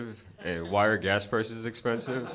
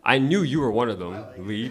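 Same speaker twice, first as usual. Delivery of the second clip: ordinary talk, nothing marked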